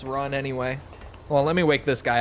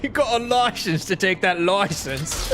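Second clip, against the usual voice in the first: high-pitched, exaggerated British accent; mock British accent; 5 semitones higher than usual. Streamer repeating the license joke, hammed-up British accent. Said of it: "my British accent, they say it's not very good".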